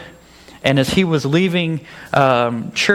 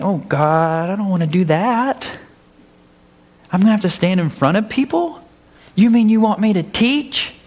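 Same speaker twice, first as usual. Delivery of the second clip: higher, pleading mock whine; whiny protest; 6 semitones higher than usual. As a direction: whine like a reluctant servant. Whining refusal to God.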